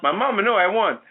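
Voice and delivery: high-pitched imitation Colombian accent; mock Colombian accent. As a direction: insist in a put-on Colombian accent. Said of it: "said in her best accent".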